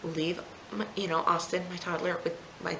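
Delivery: soft, composed retold request; very calm. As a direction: keep it quiet and controlled for mom. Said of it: "I very calmly just said".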